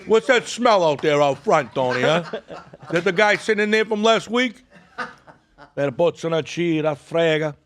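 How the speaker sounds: loud, laughing tough-guy ribbing; mock mobster banter. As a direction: play the wiseguy needling his pal. Teasing the "mob boss" guest.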